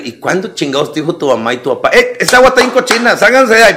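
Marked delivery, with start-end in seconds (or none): 2.0-3.7 high, louder call to get out of the puddle; mock parental scolding. Parent calling kids out of dirty puddle, louder scolding. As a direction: yell at the kids from a distance.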